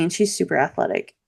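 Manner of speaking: ordinary delivery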